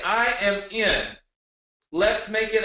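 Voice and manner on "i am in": each word slow and separate, I AM IN; slow, deliberate chant for the chat; slow spelled-out I am in. Shown as a demonstration. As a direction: say it slowly like a pledge. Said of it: "Just say".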